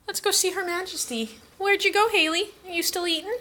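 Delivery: sing-song high call to the cat; high-pitched cat-calling voice. Real, not acted.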